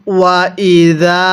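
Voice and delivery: ওয়া ইদা with the দা held one alif long; one alif stretch. Shown as a demonstration. Teacher shows the long vowel, slow and drawn. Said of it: "এক আলিফ টান দিব".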